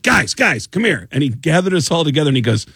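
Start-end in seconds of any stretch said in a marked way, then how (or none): 0.0-1.1 higher-pitched retold summons; calling out. [name] stopping rehearsal to gather crew, mock calling out. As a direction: gather everyone with a quick call. Band leader calls the crew over.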